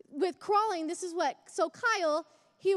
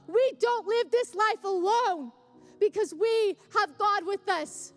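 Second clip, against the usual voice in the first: preaching; 4 semitones higher, 4 dB louder than usual. Acted. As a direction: urge the church to keep fighting. Preaching to church family.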